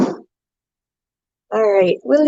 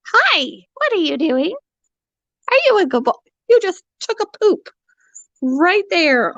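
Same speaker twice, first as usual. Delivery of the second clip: high teasing pet voice; pet talk; 8 semitones higher than usual. Real, not acted. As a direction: coo up high at the parrot. Baby talk to parrot.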